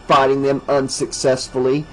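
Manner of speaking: usual speaking voice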